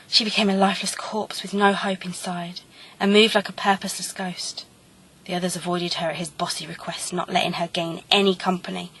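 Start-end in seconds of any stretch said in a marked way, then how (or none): none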